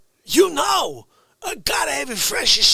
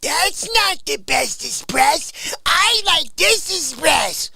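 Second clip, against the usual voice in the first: mock snob; 6 semitones higher than usual. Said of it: "You say".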